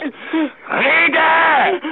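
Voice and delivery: demanding a confession, furious; furious command. Acted. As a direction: snap the order at him.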